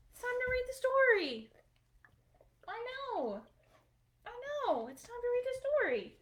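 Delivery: high, sing-song voice for a little one; sing-song coaxing. Real, not acted. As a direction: coax the little one away sweetly. High voice coaxing Sammy before story.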